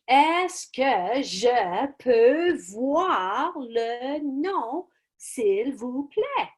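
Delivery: slow, rising drilled question; modelled polite request. Shown as a demonstration. Teacher modelling a polite question slowly for students. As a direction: model it for the kids. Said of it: "I train them to say".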